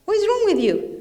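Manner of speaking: high, disbelieving what-is-wrong-with-you; incredulous retort. Retold or imitated. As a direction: scoff at an old man asking for a son. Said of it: "what would we say?".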